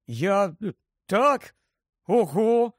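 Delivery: halting, then high and amazed; falter, then let wonder break through; bewildered delight. A narrator's reading. Harry accepts being godfather, stunned and delighted. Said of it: "Гаррі був розгублений, здивований і захоплений".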